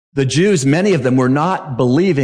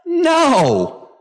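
a high, ringing No, flatly refusing the idea; emphatic no; 12 semitones higher than usual. Acted. Preacher answers his own question with a high emphatic no. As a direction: shut the question down hard.

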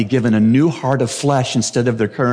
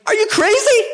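screamed. Acted. Preacher says verse four screams, very high and incredulous. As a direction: scream it in shocked disbelief. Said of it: "Verse four screams".

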